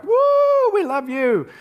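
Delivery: high, loud whooping cheer; mock crowd cheering through the streets. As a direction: cheer him on like an adoring crowd. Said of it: "clap and be like".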